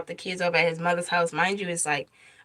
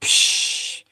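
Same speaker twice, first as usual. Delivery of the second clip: unvoiced whooshing "psh"; imitated water burst. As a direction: make the sound of the water breaking. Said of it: "my water was like".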